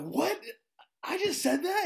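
very high, incredulous squeal; shocked disbelief. Retold or imitated. Teacher shocked by his own comeback, high mock disbelief.

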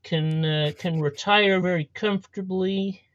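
rambling mock politician, halting and self-satisfied; bad Trump impression. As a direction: boast like a badly imitated American president. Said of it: "do a really bad impression".

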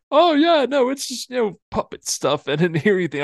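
high loud fake-innocent denial; lying to Vanini about the overheard message; nervous lie. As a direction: cover up a lie in a squeaky rush. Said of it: "I lied".

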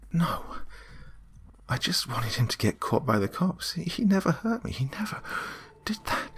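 high protest that fades away; distressed, trailing off. A narrator's reading. Karen defends Sam to the demon, trailing off. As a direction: plead high, then falter into softness. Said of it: "My voice trailed off".

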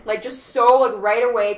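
usual speaking voice

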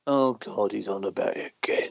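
low, quiet, fed-up groan; imitated exasperation, sinking under the breath; mock weary complaint. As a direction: act out a listener who's had enough. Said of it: "to the point that people go".

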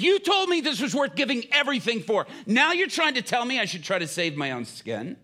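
loud, high, incredulous protest; impassioned challenge. Retold or imitated. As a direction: hammer it out at full voice, ending on a question. Prisoner challenges preacher's caution.

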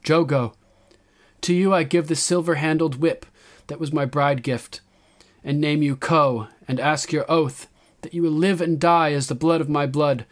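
high, loud address to her people; young queen's proclamation. A narrator's reading. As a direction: proclaim it to a whole crowd.

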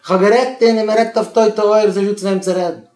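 loud, high-pitched protest; exasperated outburst. Acted. Parent protesting that talking was no use, exasperated shout.

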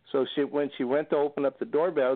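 ordinary talk

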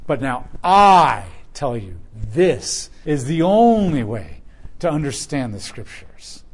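"But now I tell you" slowed and pressed; authoritative proclamation. Acted. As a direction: declare it as the final word. Jesus speaking with authority.

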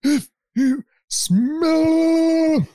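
very slow, high, hugely stretched last word; exaggerated long high wrestler's call; wrestling catchphrase. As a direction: draw it out like an arena entrance. Catchphrase sounded out after talk of it.

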